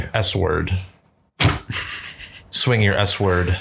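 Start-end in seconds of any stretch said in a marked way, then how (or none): 0.2-0.7 "S word" for sword, split into ess and word; exaggerated spelling pronunciation. Shown as a demonstration. Friend joking about spelling, sword split into "S word". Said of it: "pronouncing words exactly how they're spelled". 2.9-3.5 "S word" in place of sword, said ess-word; exaggerated spelling pronunciation. Shown as a demonstration. Friend joking about swinging swords, sword said as "S word". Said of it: "pronouncing words exactly how they're spelled".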